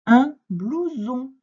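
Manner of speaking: slow, loud, high word presentation; emphatic dictation. Shown as a demonstration. Teacher giving a pupil the next word, emphatic dictation. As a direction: announce the new word slowly and strongly.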